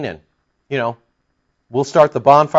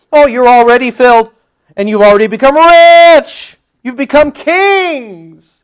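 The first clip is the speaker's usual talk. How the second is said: much higher, louder sneering taunt, slowing at the end; mocking; 8 semitones higher, 8 dB louder than usual. Paul making fun of Corinthians, loud high mockery. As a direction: ham up the fake praise. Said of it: "He makes fun of it".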